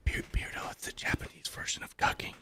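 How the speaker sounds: hushed creepy whisper. Acted. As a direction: breathe it out like a creep. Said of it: "that whispering, creepy voice".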